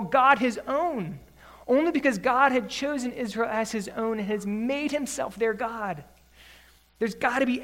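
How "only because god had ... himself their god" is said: ordinary talk, nothing marked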